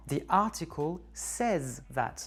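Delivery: slow, careful English sentence; correct English example. Shown as a demonstration. Teacher models the right English sentence. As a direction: spell out the correct form. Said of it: "Il faut utiliser le présent simple".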